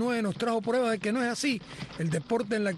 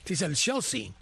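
Chelsea said the English way, a bit lower; imitating English accent; 4 semitones lower than usual. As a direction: mimic a commentator saying Chelsea in English. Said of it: "te dice el Chelsea".

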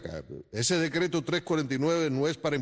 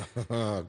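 laugh; 6 semitones lower, 4 dB quieter than usual. Real, not acted. Judge laughs at the joke.

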